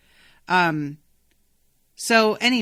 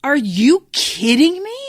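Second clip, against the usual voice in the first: higher, louder, slow indignant yell; an irritated wizard scoffing at a wizard's manual, incredulous yell; mad outburst; 6 semitones higher, 9 dB louder than usual. Retold or imitated. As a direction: blast her with angry disbelief. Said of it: "He gets so mad".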